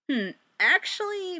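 ordinary delivery, nothing marked

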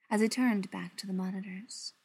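usual speaking voice

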